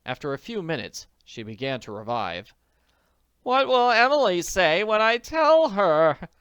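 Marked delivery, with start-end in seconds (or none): 3.4-6.3 moaning. A narrator's reading. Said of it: "she moaned over and over".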